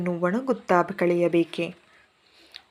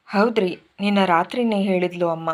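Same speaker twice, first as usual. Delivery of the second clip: ordinary delivery, nothing marked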